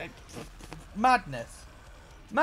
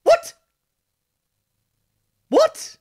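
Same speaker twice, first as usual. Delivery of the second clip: much higher, loud incredulous shrieks; shrieking disbelief; 11 dB louder, slower than usual. Real, not acted. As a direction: squeal each one in total shock. Stunned reaction after album ends.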